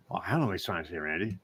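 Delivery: low, quiet dismissive reply; unimpressed helper. Retold or imitated. Clint answering Randy about the text, unimpressed. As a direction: answer low and under your breath.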